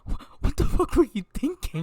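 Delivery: high, loud disbelieving what-the-hell; incredulous outburst. Real, not acted. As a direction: blurt it out in shock. Friends react to wild story.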